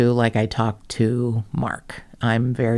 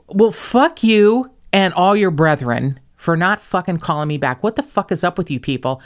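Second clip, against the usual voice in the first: retold furious rant; 5 semitones higher, 4 dB louder than usual. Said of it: "I was angry".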